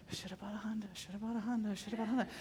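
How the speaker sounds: soft, under-the-breath repetition; hushed repeated praying. Retold or imitated. Pacing at church praying in tongues, hushed repetition. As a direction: repeat it quietly, as if to yourself.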